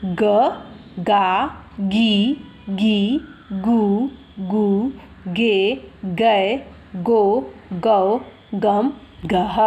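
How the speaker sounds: slow, drawn-out syllable recitation; syllable drill. Shown as a demonstration. Teacher shows how vowel signs join ग, slow syllable drill. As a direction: stretch each vowel and leave it standing alone. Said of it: "उसका उच्चारण करेंगे".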